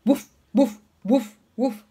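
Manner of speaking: short, loud imitated barks; dog woof. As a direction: bark like a dog. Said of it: "what does the dog say".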